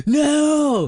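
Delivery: very high, loud hammed-up shout; mock dramatic no. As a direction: yelp the no way up high. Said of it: "we need you to be like".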